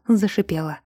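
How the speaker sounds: plain reading voice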